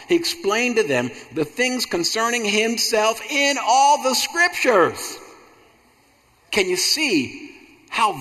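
high, impassioned preacher's cry with drawn-out key words; full-force preaching. Real, not acted. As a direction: build to a ringing pulpit climax.

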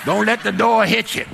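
loud, high mock farewell; taunting send-off. Clerk calling after the man storming out, taunting send-off. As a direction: call it after him dismissively.

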